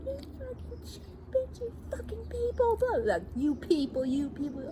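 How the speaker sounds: very high, cracking shriek; mock screeching driver. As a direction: screech like the ranting woman. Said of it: "She's like".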